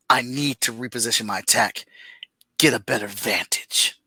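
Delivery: low, hushed comic-book narration; dramatic reading voice. Shown as a demonstration. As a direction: read it like a gripping script. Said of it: "in my best reading voice as I can possibly muster".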